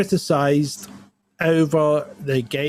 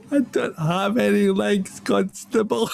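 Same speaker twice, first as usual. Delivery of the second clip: higher, slow, plaintive line; retold reply from the driver; 7 semitones higher, slower than usual. Legless driver answering the constable through laughter. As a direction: deliver it with a sad catch. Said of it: "guy goes".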